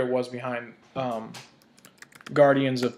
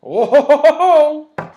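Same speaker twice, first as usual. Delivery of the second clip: high, loud mock-offended whine building to a wail; hammed-up pouty outrage, climbing higher and louder; mock whining dictator; 6 semitones higher, 10 dB louder than usual. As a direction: play a ridiculously wounded tyrant, rising into fake crying. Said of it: "what else is he gonna do?".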